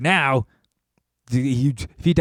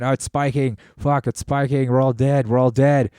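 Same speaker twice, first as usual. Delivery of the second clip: mock panic; 3 semitones higher, 5 dB louder than usual. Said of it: "May is like".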